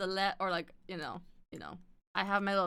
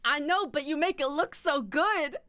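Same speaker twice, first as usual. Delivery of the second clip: usual speaking voice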